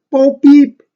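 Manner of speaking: high, loud beeping droid noise; R2 beeping after his friend. Acted. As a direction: chirp it like a little robot. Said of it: "And R two says".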